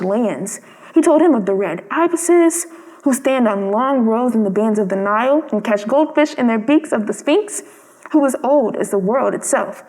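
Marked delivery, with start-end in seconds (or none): none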